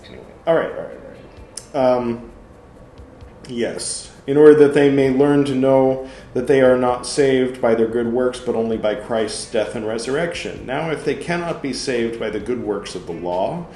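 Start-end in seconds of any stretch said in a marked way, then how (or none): 4.3-13.6 loud, emphatic reading of Luther's words; forceful sermon reading. Acted. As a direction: argue it out from the pulpit. Reads Luther's text aloud.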